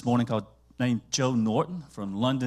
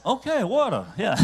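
laughing realization; 9 semitones higher, 6 dB louder than usual. Retold or imitated. Man finally understands, laughing.

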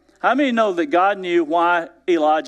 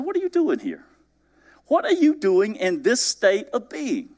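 higher, searching "What are you doing here?"; God pressing Elijah, pitched up; high emphatic question; 8 semitones higher than usual. Acted.

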